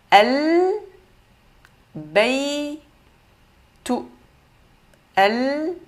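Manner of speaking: "Al-Baytu" syllable by syllable, rising long L; lettres bien prononcées. Shown as a demonstration. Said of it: "on a bien prononcé les lettres". (« Al-Baytu » détaché, L bien prononcé)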